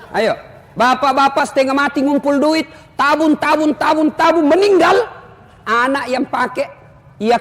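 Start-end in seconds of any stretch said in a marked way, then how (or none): 0.8-5.1 building mock exasperation. Building on saving money till death.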